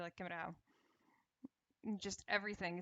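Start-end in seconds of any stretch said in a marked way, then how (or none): 0.2-0.6 "Kemet a how" squeezed short and quick; crammed-down pronunciation. Shown as a demonstration. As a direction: cram "Kemet a how" into a quieter, quick mumble. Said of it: "we just cram it down".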